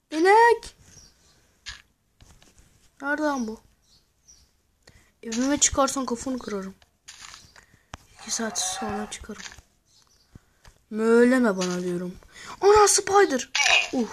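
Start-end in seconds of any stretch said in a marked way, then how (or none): 0.1-0.8 high, loud exclamation; loud, high call on spotting the cow; surprised shout. Real, not acted. 10.9-13.5 startled loud outburst at the spider; loud yell. Real, not acted. Shouts at a mob and spider.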